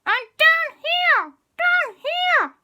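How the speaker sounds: very high, slow squeaky little voice; a tiny voice calling from the floor; teeny tiny voice. Acted. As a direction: call up from far below like a tiny mouse. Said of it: "a teeny tiny voice".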